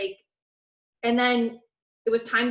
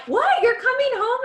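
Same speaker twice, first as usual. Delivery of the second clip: much higher and louder, thrilled disbelief; excited; 14 semitones higher, 8 dB louder than usual. Retold or imitated. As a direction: squeal it like the best news ever. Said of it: "I was so excited".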